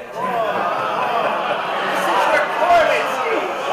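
high, bursting real laugh at his own slip; real burst of laughter. Real, not acted. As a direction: laugh out, caught off guard. Caught saying Jacoby profits.

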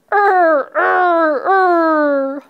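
high, loud mock crying "er"; hammed-up howls of "er"; pained wail "er". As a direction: wail each "er" as if hurt. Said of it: "Can you do it like he did?".

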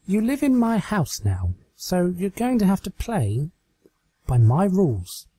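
smug, teasing command; toying with her captive; Twilight, teasing and in charge. Acted. Twilight voiced in the story.

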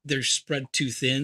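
ordinary talk, nothing marked

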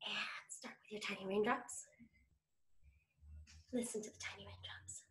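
breathy whisper for tiny raindrops. Shown as a demonstration. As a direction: keep it barely audible. Rainstorm game, quiet start.

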